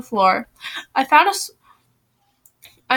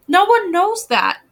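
high, slow, earnest objection; pointing out; 8 semitones higher, 3 dB louder, slower than usual. A narrator's reading. Blythe reassuring Mimi, high insistent pointing out. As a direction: point it out with emphasis. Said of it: "Blythe pointed out".

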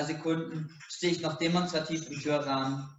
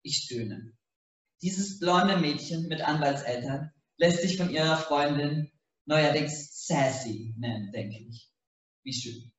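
usual speaking voice

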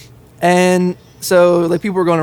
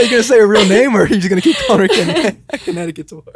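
laughing teasing; 4 semitones higher than usual. Real, not acted. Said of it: "I was about to say".

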